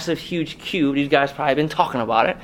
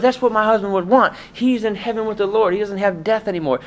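the widow's voice; 8 semitones higher than usual. Retold or imitated. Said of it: "She goes".

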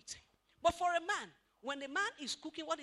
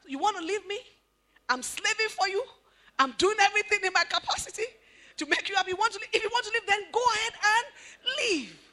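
indignant husband; 5 semitones higher, 11 dB louder than usual. Retold or imitated. Man answers wife's threat.

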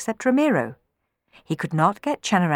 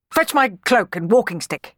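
higher-pitched curt order; 4 semitones higher, 3 dB louder than usual. A narrator's reading. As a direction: command a servant as master of the house. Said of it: "he ordered".

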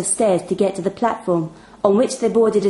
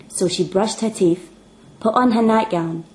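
ordinary delivery

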